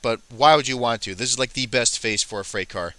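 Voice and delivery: ordinary talk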